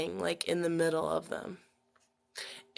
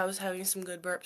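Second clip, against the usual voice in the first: ordinary talk, nothing marked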